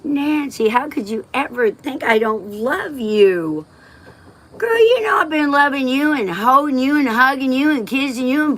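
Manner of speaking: high, gushing, sing-song mock mom; mocking the mom she never had. Daughter mocking her mother's imagined loving words, high and gushing.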